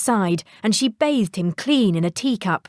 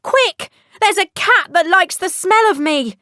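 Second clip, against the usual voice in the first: high, loud frightened call; panicked plea; 10 semitones higher, 4 dB louder than usual. Acted. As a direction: hurry her, the cat is near. Cat chasing, shouting at door.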